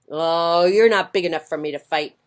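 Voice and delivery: loud dismissive brush-off; grouchy ladybug bluster. Acted. Grouchy ladybug backing off from a fight, loud and huffy. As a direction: back down while acting tough.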